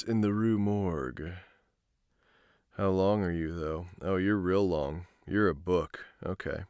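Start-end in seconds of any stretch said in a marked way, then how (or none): none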